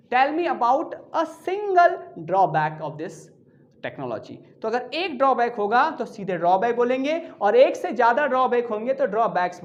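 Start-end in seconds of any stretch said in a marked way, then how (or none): 0.1-4.3 slow example sentence. Shown as a demonstration. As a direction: read the example slowly for the class. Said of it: "और अगर आप बोलेंगे". (उदाहरण वाक्य धीरे-धीरे, आख़िर में धीमा)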